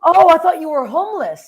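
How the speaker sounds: high, loud embarrassed outburst; retold mortified surprise. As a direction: blurt it out, horrified at your blunder. Embarrassed blunder to famous comedian.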